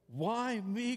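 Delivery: high, pleading cry of protest. A narrator's reading. Moses protesting God's call at the burning bush, anguished high cry. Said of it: "cried Moses".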